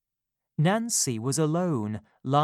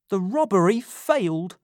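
ordinary delivery

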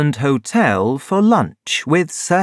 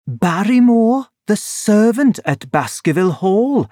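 lightly voiced doctor, pitched higher; volunteer the name in a higher character voice; higher voice for an informative doctor; helpful doctor; 7 semitones higher than usual. A narrator's reading. Voices Doctor Mortimer in story.

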